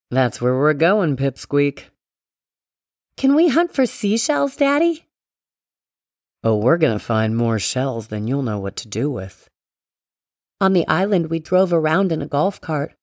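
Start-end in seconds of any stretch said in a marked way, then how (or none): 0.1-1.9 low, fond father voice; lower, gentle dad voice; daddy voice. A narrator's reading. Father talks to his daughter. 3.2-5.1 put-on high, eager child voice; little girl voice. A narrator's reading. Child asks her father. 6.4-9.5 low, playful father voice; deep, fond dad promising fun; daddy voice. A narrator's reading. Father answers his daughter.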